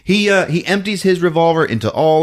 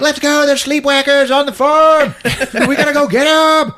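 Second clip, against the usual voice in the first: high, eager rallying; police officers rallying to leave, higher eager voice; excited cops; 5 dB louder than usual. Acted. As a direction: cops rushing out, higher and keen. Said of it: "They're all like".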